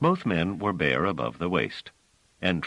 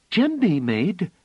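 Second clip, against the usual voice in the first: put-on high, louder eager child's voice; excitedly; 6 dB louder than usual. Phelim eagerly telling Bliss about Djembe, excited. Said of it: "said excitedly".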